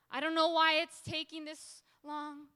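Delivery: loud, high-pitched plea; wrestling with God. Acted. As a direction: cry out in impatient confusion. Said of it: "He goes from wrestling".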